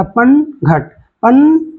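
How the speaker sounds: पन and घट as two slow, separate beats; broken into syllables. Shown as a demonstration. Teacher showing pupils where the word breaks, syllable by syllable. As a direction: split पन घट with a clear gap. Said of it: "खंडित करना है".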